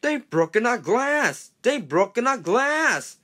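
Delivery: high, loud, slow cry of alarm; alarmed outcry. Acted. Passengers crying out in alarm. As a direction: wail that the glass is broken.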